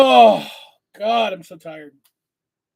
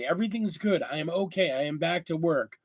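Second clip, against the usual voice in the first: usual speaking voice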